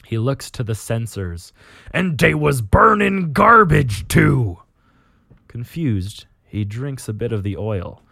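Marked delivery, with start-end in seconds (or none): 1.9-4.6 loud orc voice, pitched up. Acted. Orc captain looking at the sensors, baffled orc voice. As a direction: sound like an orc baffled by humans. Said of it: "you read an orc talking".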